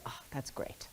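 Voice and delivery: near-whispered, breathy aside to herself; soft unvoiced retold musing; hushed inner thought. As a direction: murmur it as a private thought.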